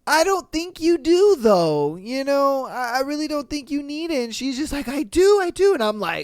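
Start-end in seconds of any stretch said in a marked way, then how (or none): 0.1-4.2 higher, coaxing disbelief; gently doubting. Retold or imitated. Husband doubting wife's Target purchase, coaxing disbelief. 4.9-5.7 high, louder whiny insistence; mock wife insisting. Wife insisting she needs it, high and whiny.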